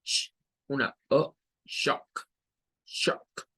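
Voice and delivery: sh, then shock with clipped o; English shock demo. Shown as a demonstration. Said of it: "Vean que lleva una C H".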